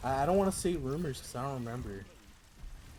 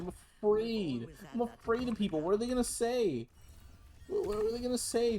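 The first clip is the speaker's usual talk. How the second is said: mock fearful; 8 semitones higher than usual. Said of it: "You think I wanna talk to people?".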